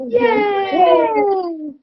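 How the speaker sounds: very high, loud cheering shout. Real, not acted. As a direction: shout with joy for the class. Teacher cheers the class.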